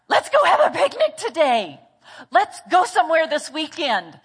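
spontaneous enthusiast. Acted. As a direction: play someone bursting with a sudden plan. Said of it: "They are spontaneous".

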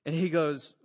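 ordinary talk

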